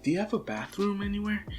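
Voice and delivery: put-on high, soft, quick girl's voice; timid polite question. Girl asking her host for the bathroom, softly polite. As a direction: ask lightly and discreetly.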